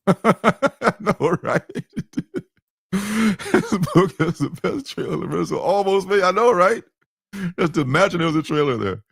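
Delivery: can't hold back the giggles at his own joke; laughing. Real, not acted. Joking about the missing trailer.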